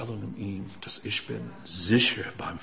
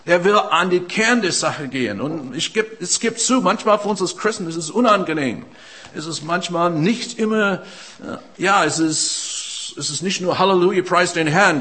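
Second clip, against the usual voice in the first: raised, ringing pulpit voice, pressing forward; fervent preaching; 7 semitones higher, 6 dB louder than usual. Acted. Preacher urging the congregation toward change, in a raised preaching voice. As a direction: preach it out to the whole room.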